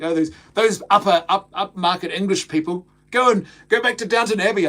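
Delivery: hammed-up taunt from a YouTube critic; critic's mock-snooty put-down. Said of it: "they're like".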